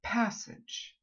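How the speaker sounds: Passage said crisp and clipped; model word for repeating. Shown as a demonstration. Accent coach models a word for the class. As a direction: Passage with a short clean a. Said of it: "repeat after me".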